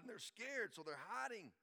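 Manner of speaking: normal talking voice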